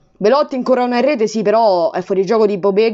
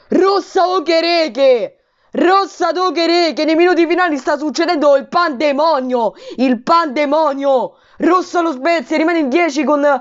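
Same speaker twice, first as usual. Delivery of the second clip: higher, urgent live call; excited commentary; 5 semitones higher than usual. Real, not acted. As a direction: announce the red card in disbelief. Red card live.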